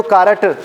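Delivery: कारट with an extra vowel after the r; mispronounced, no accent. Shown as a demonstration. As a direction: break कारट into "kaa-ra-t". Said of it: "ना accent है ना pronunciation है". (कारट, र के बाद फ़ालतू स्वर)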